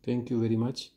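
usual speaking voice

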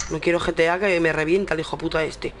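ordinary delivery

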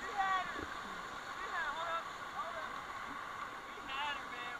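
high loud excited yelling; excited shout. Real, not acted. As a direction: yell it, thrilled. Near flip on rapid.

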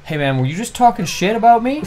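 retold challenge, pitched well up; imitated confrontational kid. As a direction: put on a kid picking a fight. Voicing a confrontational stranger.

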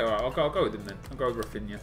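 ordinary talk

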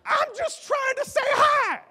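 尖声难以置信; very high, loud incredulous squeal; squeaky disbelief. Retold or imitated. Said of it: "And I'm like".

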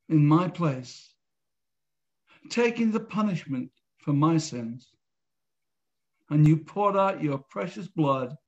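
earnest, measured prayer with long pauses; led prayer. Real, not acted. Host leading viewers in a salvation prayer, measured and sincere. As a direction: pray phrase by phrase, leaving room to repeat.

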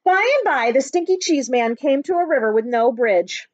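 put-on lively read-aloud for children; story narration. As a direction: tell it like a picture book to a class. Teacher reads picture book aloud.

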